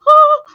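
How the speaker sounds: high, loud retold yelp of pain; mock scream. Patient re-enacting her reaction to the injection, mock scream. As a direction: re-enact a sharp cry from the needle. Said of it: "and I start screaming".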